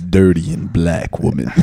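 deep leering voice; low creepy voice. Retold or imitated.

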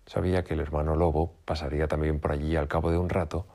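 usual speaking voice

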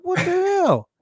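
very high stunned squeak; very high mock shock; stunned disbelief. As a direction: shriek it in disbelief. Retold disbelief at the reveal.

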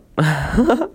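acted laugh rising in pitch; villain's laugh. A narrator's reading. Urchin laughs at the kid's money, an acted laugh. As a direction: laugh as the dealmaker eyeing the payment. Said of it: "then laughs".

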